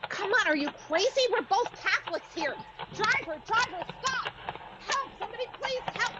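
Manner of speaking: put-on a terrified girl begging the driver to stop; panicked cry for help. Attacked in the park, calling out.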